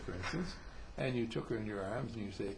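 ordinary talk

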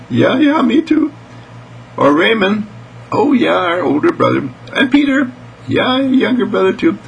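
put-on high, eager kid voice; excited child. Children naming family to love, high and excited. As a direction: chime in happily, pitched high.

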